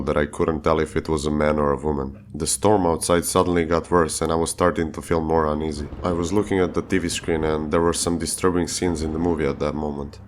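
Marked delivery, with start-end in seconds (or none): none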